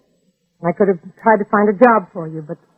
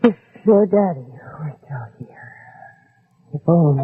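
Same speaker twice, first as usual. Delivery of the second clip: wistful whisper; 5 semitones lower, 4 dB quieter, slower than usual. Acted. Widow alone, wistful.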